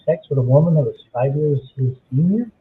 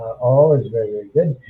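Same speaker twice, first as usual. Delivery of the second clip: ordinary talk, nothing marked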